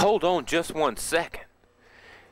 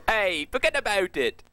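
put-on New York accent; 6 semitones higher than usual. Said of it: "in a New York accent and a little more grit".

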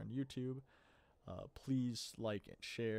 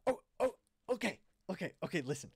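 flustered shopkeeper; 9 semitones higher, 7 dB louder, faster than usual. Acted. Nervous shopkeeper caving on price.

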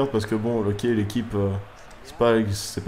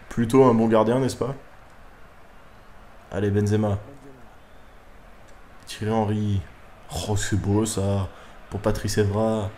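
ordinary talk, nothing marked

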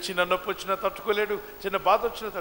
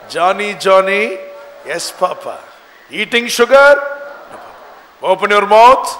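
slow, loud sing-song call and answer; nursery rhyme recital; 14 dB louder, slower than usual. Acted. Father questioning Johnny about sugar, loud nursery-rhyme chant. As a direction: chant it with gaps, punching the questions.

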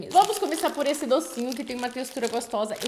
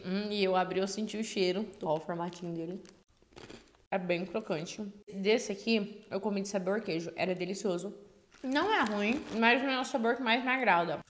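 crunchy snack bites; talking while chewing; 4 dB quieter than usual. Real, not acted. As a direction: mouth partly full. Seen 1: Bites and chews snack pieces from the bag while talking.

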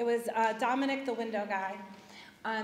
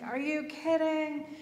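slow, higher, exasperated "are you kidding"; grumpy disbelief; 5 semitones higher, slower than usual. Retold or imitated. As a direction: drag it out like you can't believe it. Grumpy wife hears window guy's coming.

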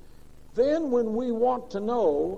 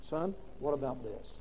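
much softer, lower imitation of a mild father; gentle dad; 4 semitones lower, 9 dB quieter than usual. Mild father gently asking his son, softly imitated. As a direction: ask it gently, the opposite of mother. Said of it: "My dad would say".

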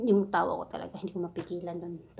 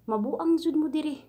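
excited; 6 semitones higher, 5 dB louder than usual. Real, not acted. Venting to viewers about bosses.

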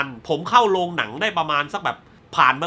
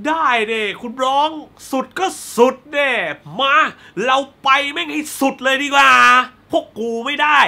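higher, hammed-up defiant villain; acted defiant retort; 5 semitones higher, 3 dB louder than usual. Cornered killer answering the detective, acted defiance. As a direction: throw the challenge back, pitched up. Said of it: "คริสอีแวนก็พูดมาว่า".

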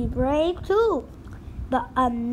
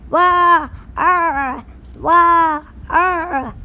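hammed-up pirate wail; 4 semitones higher, 8 dB louder, much slower than usual. Captain cries for parrot.

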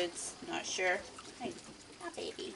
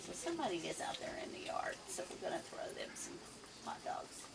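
soft whisper so as not to scare the animal; 12 dB quieter than usual. Real, not acted. Telling viewers quietly while a raccoon is in the yard, whisper. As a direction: hush it, someone's right outside.

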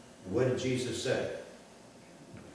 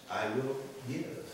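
softened voice; 5 dB quieter than usual. Acted. Zacchaeus's changed motto.

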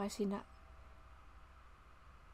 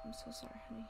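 soft apology; 10 dB quieter, faster than usual. Real, not acted. Apology to child in game.

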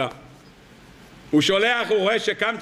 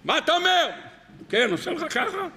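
high, raised voice over a band; shouting over music; 9 semitones higher than usual. Acted. Guests shouting to each other over the wedding band. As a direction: strain to be heard over the band.

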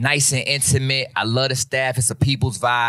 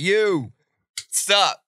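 higher-pitched retold hello; acted greeting; 7 semitones higher, much slower than usual. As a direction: greet a long-lost friend, pitched up. Acted greeting of an old friend.

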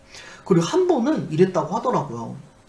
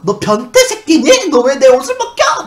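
high, loud, drawn-out shouting; furious accusation; 9 semitones higher, 12 dB louder than usual. Retold or imitated. Patient slaps man removing her socks, furious shouting. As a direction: yell in outraged accusation. Retold outburst after slapping caretaker.

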